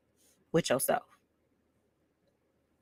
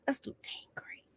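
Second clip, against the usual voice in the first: whispered to herself; 14 dB quieter, faster than usual. Shown as a demonstration.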